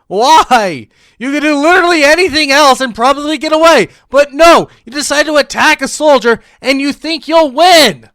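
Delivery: loud, high-pitched yelling; fed-up, ranting outburst; exasperated rant. Real, not acted. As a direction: shout in disbelief at her stupidity. Exasperated rant at character's choice.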